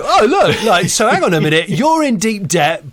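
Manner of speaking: hammed-up excited interruption; excitable presenter. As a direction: burst in as an over-keen presenter. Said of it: "then he'd go".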